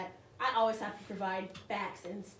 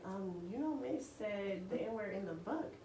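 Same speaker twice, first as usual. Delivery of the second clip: put-on kids telling on teacher; 7 dB quieter than usual. Students reporting the English teacher to other teachers, hushed tattling. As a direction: imitate a child hesitantly reporting a teacher.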